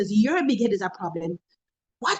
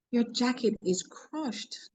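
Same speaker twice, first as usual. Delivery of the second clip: quiet private word; 6 dB quieter, much slower than usual. Retold or imitated. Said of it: "I took her in my office and I said to her".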